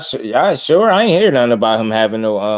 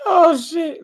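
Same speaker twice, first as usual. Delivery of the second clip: laughing outburst. Real, not acted.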